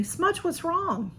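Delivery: high, slow, drawn-out question to a cat; real concern in a high, slow pet-owner voice; concerned pet talk. Real, not acted. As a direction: coo it slowly up high to a worried pet. Talking to her meowing cat.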